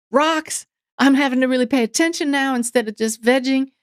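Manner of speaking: usual speaking voice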